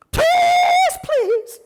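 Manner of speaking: high, loud pleading cry. Retold or imitated. [name] begs [name] to stay, shouting please high. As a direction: beg like a soul singer on his knees. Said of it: "[name] looked like [name]".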